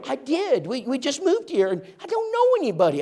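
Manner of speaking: high falsetto lady voice; woman at the door. Retold or imitated. Woman at door answering preacher.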